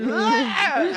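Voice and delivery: high, loud battle yell; short imitated scream while lunging to fight; reenacted scream. Storyteller charging at the ghost, high mock scream. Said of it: "scream at the top of my lungs".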